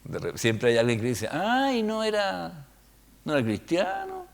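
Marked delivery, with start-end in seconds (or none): 1.3-4.2 put-on high voice of a critic; mock critic. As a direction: mimic a fault-finder, high and softly scandalized. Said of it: "que nos va a criticar".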